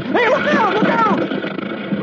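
very high, panicked yelp; alarmed warning cry. Acted. Dolfo warning Roy of a falling boulder, alarmed cry. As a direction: yell a sudden warning, pitch shooting up.

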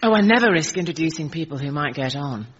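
ordinary delivery

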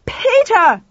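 high, loud outraged bellow; undignified yell for someone. A narrator's reading. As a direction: bellow the name with all dignity gone. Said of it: "Lauren bellowed, all dignity gone".

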